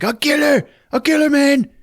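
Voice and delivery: high, loud ranting thug voice; mock villain yell. Gunman yelling at hostages, high mock shout. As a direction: screech it like a crazed gunman. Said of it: "And he's like".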